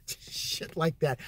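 normal talking voice

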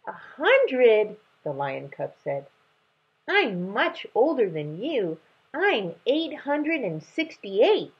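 put-on high, slow childish boast; boasting cub. Lion cub boasting to the young dragon, high childish brag.

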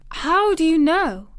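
high, loud, unsympathetic challenge. A narrator's reading. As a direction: challenge him without any pity. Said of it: "said Mary unsympathetically".

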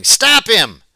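sharp high shout after a thief. A narrator's reading. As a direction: yell it out, chasing someone.

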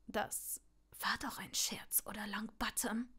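hissed whisper ending on a rising question. Acted. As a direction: hiss it anxiously. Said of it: "zischte er".

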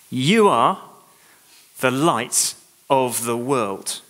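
"You are" lifted, then a slow "the light of the world"; stress on "You are". Shown as a demonstration. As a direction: hold each word of "the light of the world". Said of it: "the ones I want you to remember".

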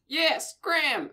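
high, slow squeal; high squeaky shout. Acted. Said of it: "a much higher pitched voice screamed".

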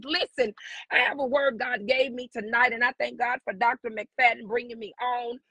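ordinary talk

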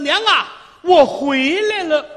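high, drawn-out call to mother at the door. Acted. Son calling his mother from the yard, high and slow. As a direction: stretch the call out slowly, reaching for her. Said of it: "眼望着房门喊了一声".

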